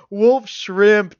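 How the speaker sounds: high, loud gleeful jeer; guffawing. A narrator's reading. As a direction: crow it as if cracking up. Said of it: "Dane guffawed".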